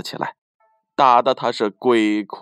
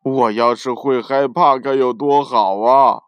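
irritable grumbling; 3 dB louder than usual. Acted. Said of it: "心情烦躁地唠叨着".

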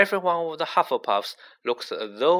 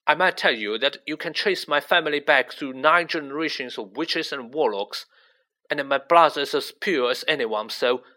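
plain reading voice

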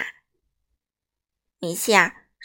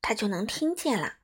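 usual speaking voice

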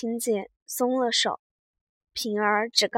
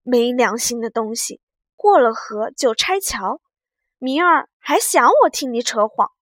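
louder, gritted-teeth scolding; through gritted teeth; 7 dB louder than usual. A narrator's reading. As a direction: bite the words out at him. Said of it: "平儿咬牙道".

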